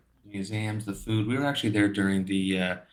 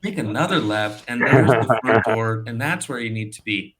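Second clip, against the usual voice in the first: brisk, higher, louder directions from the clerk; sudden perfect English; 4 semitones higher, 7 dB louder than usual. Retold or imitated. Clerk suddenly gives tourist directions, brisk and loud. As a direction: rattle off directions, suddenly fluent. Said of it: "she was in perfect English".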